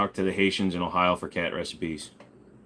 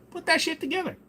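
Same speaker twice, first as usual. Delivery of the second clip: much higher excited outburst; excited squeal; 4 dB quieter than usual. Real, not acted. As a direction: squeal it with glee. Excited squeal about poutine.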